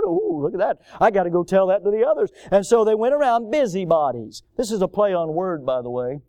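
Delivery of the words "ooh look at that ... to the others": high, eager gossip voice, hurrying on; a busybody thrilled to spread news; mock gossiping busybody. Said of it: "they'd pick up a little news".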